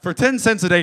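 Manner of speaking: high, loud mock-advert shout; loud parody appeal. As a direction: blare out the charity pitch. Own louder version of advert.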